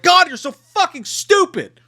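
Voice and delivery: high, drawn-out exasperated shout; exasperated yell. Retold or imitated. Viewer yelling at a character on screen, exasperated. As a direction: yell it slowly at a hopeless idiot.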